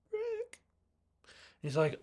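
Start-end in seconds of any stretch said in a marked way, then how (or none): 0.1-0.6 much higher whiny pout; imitating a pouty girlfriend; pouty plea. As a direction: coax him with a pout. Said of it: "gives him a little pouty face".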